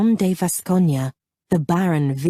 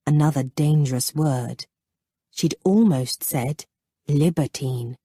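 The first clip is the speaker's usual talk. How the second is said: ordinary delivery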